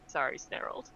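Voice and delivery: breathy whisper, sheepish; whispered apology. Acted. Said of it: "she's just like".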